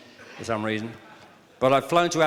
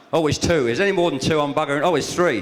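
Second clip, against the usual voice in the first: louder, higher, hammed-up amazement at each extra hand; mock surprised counting; 6 semitones higher, 6 dB louder than usual. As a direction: play up the shock as the numbers grow. Counting Scots who raise hands.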